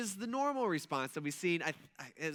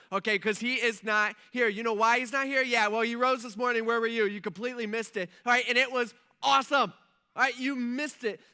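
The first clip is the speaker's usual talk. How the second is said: loud, high, gushing excitement; pumped angel; 5 semitones higher, 8 dB louder than usual. Pretend. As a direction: crow over the news as a hyped angel. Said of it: "this angel's probably pretty pumped".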